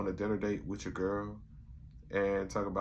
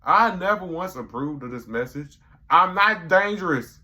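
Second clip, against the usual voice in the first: loud and high, slowing a bit; hammed-up campaign-ad delivery; mock announcement; 9 semitones higher, 9 dB louder than usual. [name] voicing a political ad, loud and high. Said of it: "He's like".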